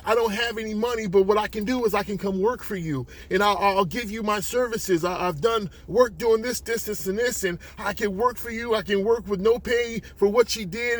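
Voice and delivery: high, urgent pleading; desperate mother bargaining. Acted. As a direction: plead and bargain, words tumbling out.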